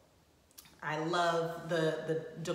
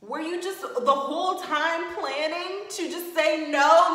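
exasperated rant; 6 semitones higher, 5 dB louder than usual. Real, not acted. Ranting at the groom.

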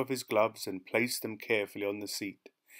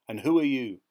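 louder, higher, abrupt demand; sharp question; 4 semitones higher, 7 dB louder than usual. A narrator's reading. Policeman challenging the passenger, sharp and loud. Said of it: "he asked sharply".

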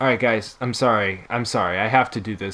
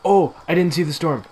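fast, higher panicky rush; panicked; 5 semitones higher, 3 dB louder, faster than usual. Real, not acted. Gamer panicking at the storm he missed, fast. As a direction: caught off guard and scrambling.

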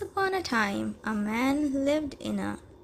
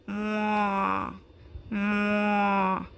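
cow moo; 2 semitones lower than usual. Acted. Narrator voicing the cow for children, long moo. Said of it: "The cow said".

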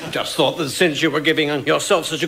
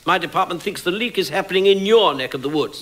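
normal talking voice

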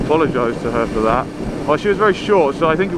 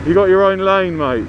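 irritated shout; 7 semitones higher, 4 dB louder than usual. Real, not acted. Shouting at a driver.

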